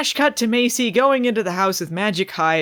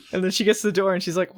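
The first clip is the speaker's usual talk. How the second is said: ordinary talk, nothing marked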